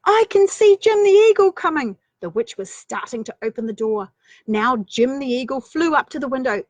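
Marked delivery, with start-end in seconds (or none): 0.0-2.0 put-on high, eager girl's voice; a small girl pointing something out; high excited child voice; little girl voice. Children at tower window.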